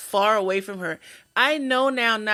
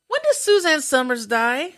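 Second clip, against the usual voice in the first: high, raised-voice imitation of a nosy woman; very loud question; 6 semitones higher, 4 dB louder than usual. Patient asking the whole therapy room, very loud imitation. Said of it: "she says it very loud again".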